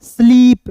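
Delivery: "स्लीप" with a long, stretched ee; long drawn-out ee. Shown as a demonstration. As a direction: stretch the vowel of "स्लीप", pitched slightly up. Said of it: "थोड़ा सा खींच कर पढ़ेंगे".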